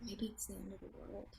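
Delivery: whispered, hushed. Acted. Musing to him about the end of the world, whispered. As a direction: breathe it out with quiet dread.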